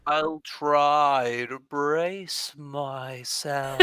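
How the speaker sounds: put-on extremely drawn-out, weird accented voice; Elaxi in slow motion. Slowed adventurer trying to brace for a fall, very slow. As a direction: drag every word out like slowed-down playback. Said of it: "Elaxi's accent plus slow is hard".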